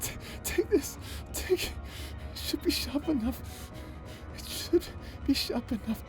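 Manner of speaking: soft, trembling, half-whispered through tears; tearful hush. Acted. Offering the blade to the hero, softly crying. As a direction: hand over the knife in a broken hush.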